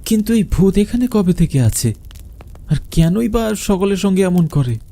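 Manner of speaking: put-on nervous young man asking about the ghost; anxious question.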